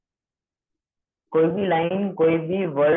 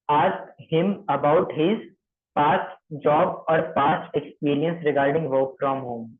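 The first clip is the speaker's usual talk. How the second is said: very slow, word by word; slow dictation; much slower than usual. Shown as a demonstration. Trainer dictates the script for trainees, very slow. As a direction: spell it out slowly for note-taking. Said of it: "ये सारी चीजें लिखने के लिए".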